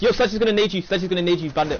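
loud, high callout; urgent warning shout. Real, not acted. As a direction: yell the warning to a teammate. Warning teammate mid-fight.

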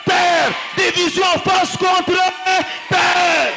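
loud, driving sermon delivery, French and Swahili; fiery preaching. Acted. Preacher rousing the congregation to rise, shouting. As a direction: preach it to the crowd at full force.

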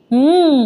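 short closed-mouth hum of yes; mm-hmm, just humoring her; humming assent. A narrator's reading. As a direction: hum a lazy yes through closed lips. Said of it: "ఊ కొడుతున్నాడు".

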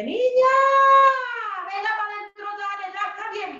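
high-pitched mother calling her in. Retold or imitated. Mother calling her daughter back into the house, high-pitched.

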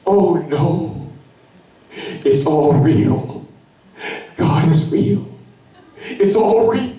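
it's all hitting you at once, rising to a cry; retold moment of awestruck panic; scared amazement. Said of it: "I started to get scared".

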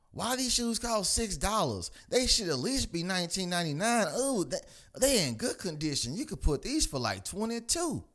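high, loud mock nagging voice; imitating scolding coworker. Comic voicing the coworker scolding him over shoe prices, high and pushy.

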